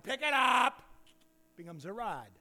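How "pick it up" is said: high, loud, commanding God; a high, loud divine question; God's voice. Acted. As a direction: call down as God to Moses.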